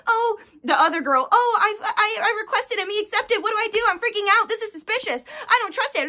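high, fretful mock client voice; mocking high anxious client; panicky client mockery. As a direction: parody a frantic girl asking for help.

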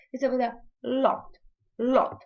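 "лот" with l made where teeth meet palate; soft Polish l. Shown as a demonstration. Teacher demonstrates the Polish l to learners, carefully. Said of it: "Л ми виговорюємо м'яко".

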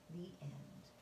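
soft whispered close to the story; whispered ending. Acted. Storyteller closing the tale for children, whispered. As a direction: breathe the last words out.

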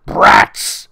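loud emphatic brats; loud repeat. Shown as a demonstration. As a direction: punch the word brats hard.

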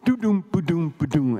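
beatbox the drum fill with your voice; drum solo imitation. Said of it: "and it went".

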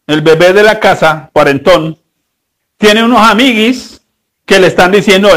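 ordinary delivery

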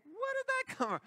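high, startled squeak; startled Mary. Acted. Mary startled by cheese puffs thrown at her, high squeak. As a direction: yelp like you've just been hit. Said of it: "Mary's like".

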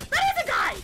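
startled yell; shocked. Real, not acted. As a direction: shout it in sudden surprise. Shocked at spotting an enemy.

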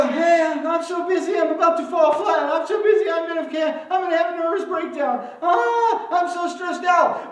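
high, loud, panicky overwhelmed rant; loud, high mock frazzled whine; frantic stressed voice. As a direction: act out someone at the end of their rope. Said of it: "We go".

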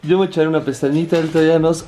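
normal talking voice

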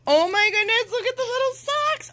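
high, quick gush of delight; thrilled, high-pitched cooing; excited squeal. Real, not acted. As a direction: squeal over something tiny and cute. Finding tiny socks in package.

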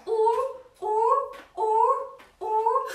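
high, loud sing-song hoots; walrus ooh calls. Pretend. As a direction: hoot like a playful walrus. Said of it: "and then lift your tail".

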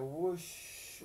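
airy whooshing wind noise; whoosh sound. Acted. Reading to a child, a gusting wind whoosh.

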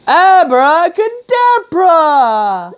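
high, drawn-out spell cry; magic incantation. Acted. Dog casting its new barking spell, drawn-out high incantation. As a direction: stretch the magic word out, pitched high.

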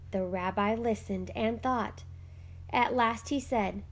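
plain reading voice

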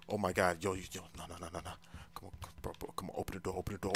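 very soft, low, urgent pleading; hushed panic. Acted. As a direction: beg the door to open without being heard. Trying to escape unheard.

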